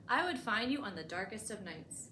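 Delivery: ordinary delivery, nothing marked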